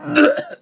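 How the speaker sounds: sputtering cough after a sip. Real, not acted. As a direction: cough from a bad swallow.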